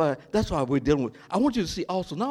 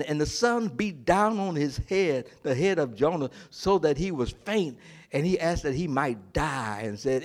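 usual speaking voice

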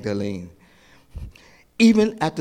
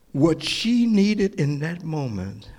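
ordinary delivery

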